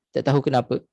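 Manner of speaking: usual speaking voice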